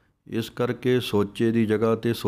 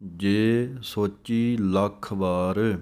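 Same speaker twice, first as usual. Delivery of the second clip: slow measured chant; slow recitation; much slower than usual. Shown as a demonstration. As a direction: recite each word slowly. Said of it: "ਇੱਥੇ ਵਿਸਰਾਮ ਹੈ". (ਹੌਲੀ ਹੌਲੀ ਪਾਠ)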